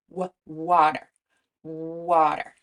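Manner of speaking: "water" with a rounded stretched w; w sound demo. Shown as a demonstration. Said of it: "So try that w".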